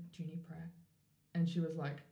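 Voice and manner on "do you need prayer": much softer, shy question; timid real ask. Retold or imitated. Asking the unstable woman on the street, softly and shyly. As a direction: barely dare to ask it. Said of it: "I said".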